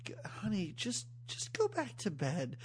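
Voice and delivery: a lightly imitated soothing mother; imitating the mom. Mom sending sweaty daughter back to bed, softly. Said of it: "I love how the mom's like".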